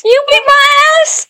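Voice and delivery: put-on very high squeaky toddler voice; imitating toddler. Friend echoing the toddler's phrase, very high squeaky voice. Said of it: "Now Morris goes around".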